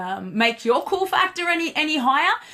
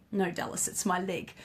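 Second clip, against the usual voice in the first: deadpan reply; 3 semitones lower, 10 dB quieter than usual. Retold or imitated. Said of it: "And I'm like".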